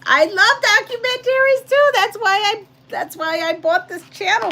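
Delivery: high, loud gushing; bubbling over about documentaries; excited. Real, not acted. Telling viewers she loves documentaries, gushing high and loud. Bursting with enthusiasm.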